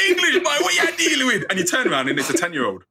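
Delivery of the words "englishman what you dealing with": high Caribbean call, laughing through it; mock the local kid's Caribbean greeting while laughing. Local kid calling out to the English visitor, laughing Caribbean accent. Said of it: "you hear them go".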